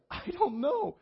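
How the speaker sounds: very high, helpless squeal; mock panic. Cornered by a second stranger's question, mock panic. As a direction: imitate a flustered, high-pitched outburst.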